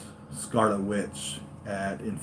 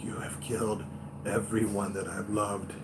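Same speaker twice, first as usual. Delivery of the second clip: softer imitation of a character's foreign accent; Scarlet Witch's accent; 9 dB quieter than usual. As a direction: quietly put on her accent. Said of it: "in her accent, she's like".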